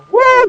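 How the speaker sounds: high excited woo; excited whoop. Real, not acted. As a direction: whoop with thrill. Riding into heavy rain.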